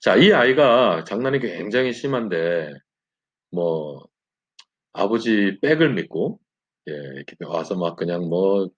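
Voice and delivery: ordinary talk, nothing marked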